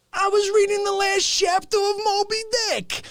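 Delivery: high mock voice; imitating Fauci. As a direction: quote him in a silly high voice. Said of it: "He goes".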